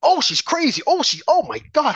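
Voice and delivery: high, hammed-up shocked pundit; sneering impression of outraged media; mocking gasp.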